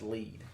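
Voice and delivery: "Lead" pronounced with a long E; Lead as "leed". Shown as a demonstration. Host passing on the pronunciation he heard, "Lead" as "leed". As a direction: say "Lead" to rhyme with need. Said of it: "I've heard it pronounced".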